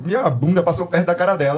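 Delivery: ordinary delivery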